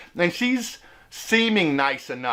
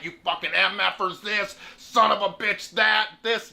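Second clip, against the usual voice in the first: raised mock throat-device voice; cussing electrolarynx imitation; 3 semitones higher than usual. Old local cussing out stranger, mock electrolarynx rant. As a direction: imitate an old man cussing through his buzzer. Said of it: "He is cussing me out left and right".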